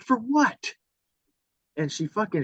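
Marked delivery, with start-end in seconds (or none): 0.0-0.7 high, incredulous question; retold incredulity. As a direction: shoot up in pitch, baffled by the threat. Retold reply to threat of hitting.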